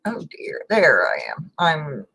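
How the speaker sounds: normal talking voice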